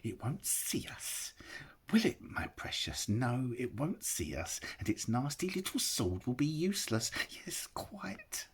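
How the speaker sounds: high hissing creature whisper; Gollum, scheming. A narrator's reading. Gollum plotting to catch Bilbo unseen, high whisper. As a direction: plot in a sly hiss.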